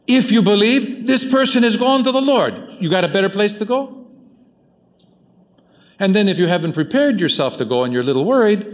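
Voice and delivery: high-pitched, insistent pulpit voice; impassioned preaching. Real, not acted. Preaches with raised voice.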